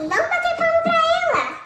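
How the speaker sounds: imitating a passenger urging applause in a high mock voice; mocking the instigator. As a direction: ham up the guy egging everyone on. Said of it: "o cara tá assim".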